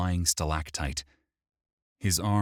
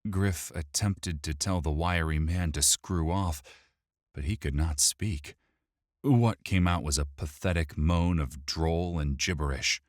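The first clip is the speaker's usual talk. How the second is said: plain reading voice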